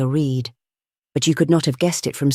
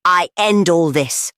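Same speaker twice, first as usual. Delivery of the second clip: slow, higher, weighty declaration; heavy as stone; 4 semitones higher, 3 dB louder, slower than usual. A narrator's reading. Gregory ending the debate, heavy as stone. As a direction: lay each word down heavily. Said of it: "in a voice as heavy as stone".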